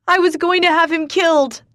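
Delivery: high, loud screamed confession; screaming. Acted. Katya screams at Ford, high and loud. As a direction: scream the guilt at him. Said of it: "I scream".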